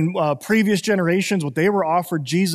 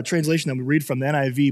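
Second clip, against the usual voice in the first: ordinary delivery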